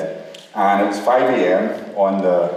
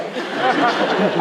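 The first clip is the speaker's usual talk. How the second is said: a short high burst of laughter; 13 semitones higher than usual. Real, not acted. Speaker laughing at his own disbelief. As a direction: laugh it off, amused.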